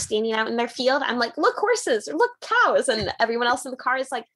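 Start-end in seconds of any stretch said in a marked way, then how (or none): 1.4-2.9 excited pointing out. Retold or imitated. Pointing out animals in car.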